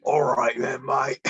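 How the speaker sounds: put-on rustic British seaman's accent; rustic British sailor. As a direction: project a mock British sailor from the throat. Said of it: "a really rustic British sailor".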